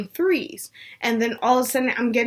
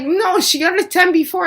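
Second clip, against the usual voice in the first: higher-pitched, emphatic contradiction; insistent protest; 7 semitones higher, 4 dB louder than usual. Retold or imitated. Mom correcting the nurse about the ten, insistent. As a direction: push back firmly as a retold mom.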